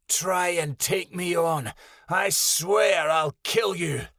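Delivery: slow, high, menacing threat; menacing beast. Acted. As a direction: taunt it slowly, ready to kill. Lilja threatens the monster.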